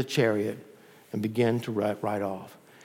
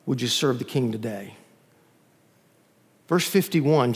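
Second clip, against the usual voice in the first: ordinary delivery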